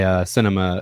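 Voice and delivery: ordinary delivery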